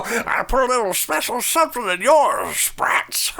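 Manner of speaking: conspiratorial grandpa whisper at the end; sly grandpa voice. Retold or imitated. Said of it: "You go".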